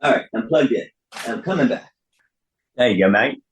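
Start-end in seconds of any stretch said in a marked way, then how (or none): 2.8-3.4 light Australian twang, leaning on mate; Australian accent. Shown as a demonstration. Actor slips into his Hugh Jackman Australian accent. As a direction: tip a casual Australian accent into it. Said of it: "as he hits with the extra Australian accent".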